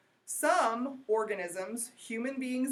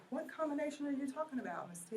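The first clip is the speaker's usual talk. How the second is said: higher, softer, quick student voice; imagined student question; 5 semitones higher, 6 dB quieter, faster than usual. Pretend. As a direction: pretend to be a student piping up quietly. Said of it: "I think I heard somebody say".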